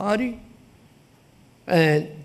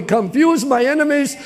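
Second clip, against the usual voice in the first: fervent cry; 8 semitones higher than usual. Shown as a demonstration. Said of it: "We gotta learn how to cry that way".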